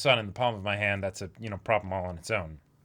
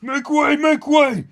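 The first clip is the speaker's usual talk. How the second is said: shouted announcement; 10 dB louder than usual. Acted. Reading manga, calling out army.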